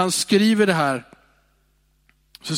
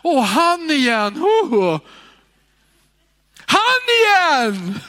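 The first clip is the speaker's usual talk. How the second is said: higher, louder exasperated groan; exasperated sigh; 9 semitones higher, 6 dB louder, slower than usual. Shown as a demonstration. Preacher mimicking an annoyed churchgoer, exasperated groan. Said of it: "nästa gång du suckar".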